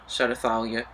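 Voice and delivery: ordinary delivery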